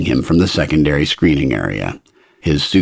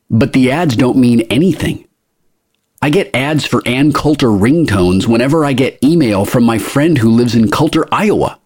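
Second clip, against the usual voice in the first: pitched-up, exasperated protest; protesting traveler; 7 semitones higher than usual. A narrator's reading. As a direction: protest with mild disbelief. Greg protests to interrogator.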